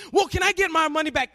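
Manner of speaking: high-pitched mimicked demand; upset passenger. Angry passenger asks counter agent for refund, upset. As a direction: push it out as an indignant customer. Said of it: "he's just getting so".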